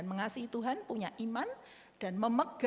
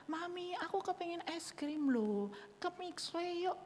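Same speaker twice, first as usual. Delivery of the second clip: put-on child asking; 6 semitones higher than usual. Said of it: "Kalau mereka bilang".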